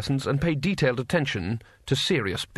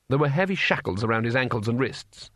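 usual speaking voice

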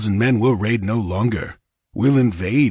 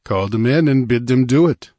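louder, defiant challenge; challenging; 4 semitones higher, 6 dB louder than usual. Acted. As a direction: dare him outright. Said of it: "challenged Conan".

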